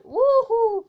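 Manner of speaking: loud high whoop; excited cheer. Real, not acted. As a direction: whoop with joy. Cheer after killing gnomes.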